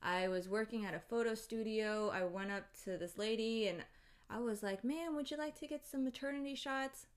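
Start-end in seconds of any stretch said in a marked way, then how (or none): 4.8-7.1 higher-pitched, mock customer-service voice; polite sales pitch. Photographer offers a lady maternity shots, politely chirping. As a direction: imitate a courteous photographer's offer.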